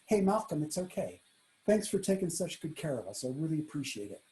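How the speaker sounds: soft, sincere thanks; reassuring. Retold or imitated. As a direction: quietly comfort and thank someone. Kind diner reassuring and thanking waiter.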